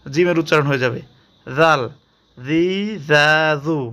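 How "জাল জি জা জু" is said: জি জা জু soft, not hard like jim; soft tongue-tip syllables; soft dhal. Shown as a demonstration. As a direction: keep জা soft. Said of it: "একটু শক্ত করে পড়া যাবে না".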